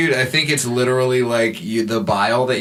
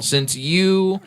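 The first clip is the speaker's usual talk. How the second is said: normal talking voice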